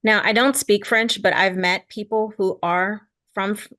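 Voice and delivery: usual speaking voice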